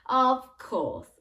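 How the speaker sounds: usual speaking voice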